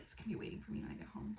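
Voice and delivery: fast, breathless whisper to herself; excited whisper. Retold or imitated. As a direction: whisper it quickly, full of anticipation. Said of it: "and I was like".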